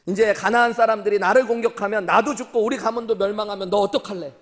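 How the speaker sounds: imitating a worried, angry father's rebuke; retold scolding. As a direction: scold loudly, as a preacher retelling it. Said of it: "이렇게 아들을 혼내는 거예요".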